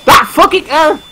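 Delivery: high, slow, exaggerated lament; hammy Italian outburst. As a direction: cry it out like a stage Italian. Exaggerated outburst on saw blades.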